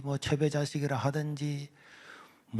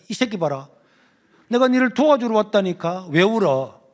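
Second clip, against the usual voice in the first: much louder, higher scolding; angry teacher; 6 semitones higher, 7 dB louder than usual. Retold or imitated. Teacher berating the crying student, angry shout. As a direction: shout it, fed up.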